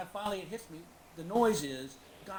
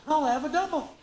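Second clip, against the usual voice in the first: loud drugged quip; 7 semitones higher, 8 dB louder than usual. Retold or imitated. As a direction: call out for a double, laughing. Drugged joke to nurse.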